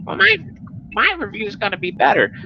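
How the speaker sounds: high smug boasting voice; mocking impression. High smug voice imitating the rival boasting in comments. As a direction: brag it, pitched high. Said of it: "he's automatically in there, like".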